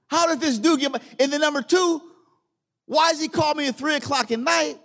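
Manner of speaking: high-pitched, baffled questioning; retold incredulity. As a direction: replay your disbelief, pitch jumping well up. Recounts his baffled reaction to the call.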